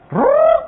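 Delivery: high loud rolled rrrr; whirring sound. Shown as a demonstration. Showing how God switched languages, high rolled rrrr. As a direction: spin the tongue like a switch flipping. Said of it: "sekejap itu kan".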